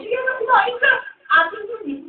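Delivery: generous father. Acted. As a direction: play the generous dad offering grapes. (masiglang boses ng ama)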